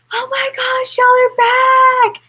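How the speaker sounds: usual speaking voice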